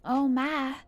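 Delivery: high Southern Belle lament; Southern Belle accent. Acted. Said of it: "Southern Belle accent is kinda like".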